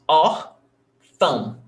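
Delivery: órfão slowly broken into ór, then fão; split into syllables. Shown as a demonstration. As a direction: separate the two syllables of órfão. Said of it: "we have two syllables".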